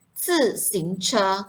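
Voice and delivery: "Zi xing che" stretched slowly, each tone clear; slow model pronunciation. Shown as a demonstration. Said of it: "ada yang tak reti sebut dengan betul". (sebut "Zi xing che" perlahan dan jelas)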